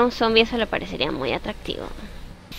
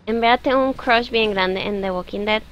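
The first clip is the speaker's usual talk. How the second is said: ordinary delivery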